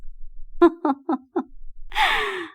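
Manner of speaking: charmed giggle; light laugh. Acted. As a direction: laugh fondly at the flattery. Mother laughs at son's flattery.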